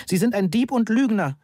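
high-pitched, restrained narrated shout; angry shout. A narrator's reading. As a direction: hurl the accusation, pitched well up. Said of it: "schrie ich".

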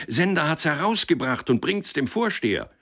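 high, excited shout; calling to one another. A narrator's reading. Townsman calling the news to another, shouted high. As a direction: pass the news on in a high call. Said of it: "weil einer dem anderen zurief".

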